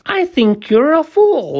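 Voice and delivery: a high jeering kangaroo voice; sour kangaroo laughing. A narrator's reading. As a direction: sneer it out mockingly. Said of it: "laughed the sour kangaroo".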